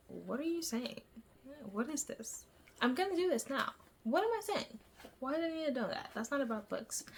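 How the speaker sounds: high-pitched incredulous questions; exasperated self-mockery. Vlogger scolding herself while editing, exasperated.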